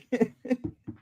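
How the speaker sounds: high, quick burst of laughter. Real, not acted. Laugh at America joke.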